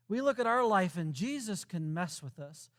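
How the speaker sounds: usual speaking voice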